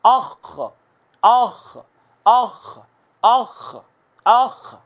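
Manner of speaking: अख with a thick guttural ख from the upper throat; heavy throaty kha. Shown as a demonstration. Quran teacher drilling students on a heavy अख. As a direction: voice the ख heavily from the throat. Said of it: "आप ज़रा मेरे बात कहिएगा".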